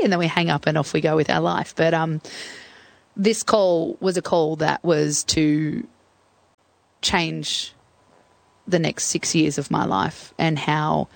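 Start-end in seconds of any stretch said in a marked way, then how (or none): none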